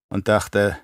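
ordinary delivery

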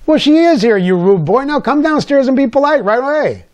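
mother scolding her son for being rude to her guest. Retold or imitated. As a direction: scold him like a cross mother.

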